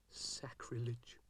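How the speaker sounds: slow, soft disgusted repeat; disgusted mutter. A narrator's reading. Dougal repeats his disgust at the pipes, soft and slow. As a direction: repeat it under your breath, slowly.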